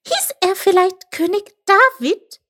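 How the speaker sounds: high, excited little voice. A narrator's reading. Chip guesses the forgotten king's name, excited high question. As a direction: ask it loudly in an excited squeak. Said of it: "sagte Chip aufgeregt".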